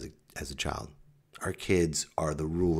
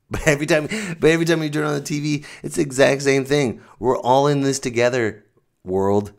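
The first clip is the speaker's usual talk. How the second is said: higher, louder, hammed-up slogan; mock TV announcer; 7 semitones higher, 6 dB louder than usual. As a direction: blare it like a cheesy TV ad. Mocking TV slogans.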